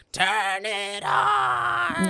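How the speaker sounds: imitating a drawn-out, very high yell; high-pitched shriek. Acting Aunt Beth yelling at Cassie, high shriek. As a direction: shriek it slowly at the top of your range. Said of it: "she yells at Cassie".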